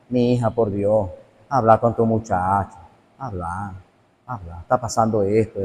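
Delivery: low, hushed voice of a messenger pleading; súplica en voz baja. Acted. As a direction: plead softly and low, as if in private. (súplica suave y grave, en voz baja)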